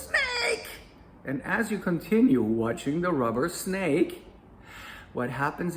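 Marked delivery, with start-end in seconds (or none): 0.1-0.7 very high, mock yelp of fright; startled yelp. The subconscious fear reacting to a snake, high yelp. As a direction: squeak it like the panicking gut. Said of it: "The subconscious fear goes".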